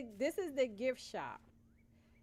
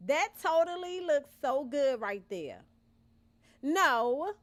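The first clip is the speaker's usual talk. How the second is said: excited; 3 semitones higher, 8 dB louder than usual. Real, not acted. Admiring build.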